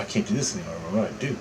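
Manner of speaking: soft, defeated-sounding self-talk, retold. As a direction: say it low, as if only to yourself. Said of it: "I just tell myself".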